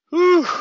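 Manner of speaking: a big, high, loud whoosh of relief; loud high whew. Real, not acted. Letting off steam after his rant, loud high whew.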